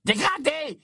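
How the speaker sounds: very high, screechy condemnation; piercing outraged harpy voice; hissing librarian. A narrator's reading. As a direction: spit it out like a scandalised librarian. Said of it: "dit-elle d'une voix sifflante".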